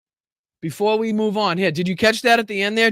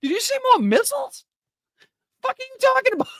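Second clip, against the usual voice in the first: very high mock disbelief; confused reporter; faster than usual. Streamer replays the reporter's question, high-pitched confusion. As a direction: squeak it out like a baffled reporter. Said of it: "confusion in the reporter's voice".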